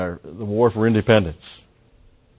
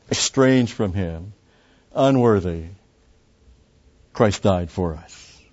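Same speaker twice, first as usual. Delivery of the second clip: normal talking voice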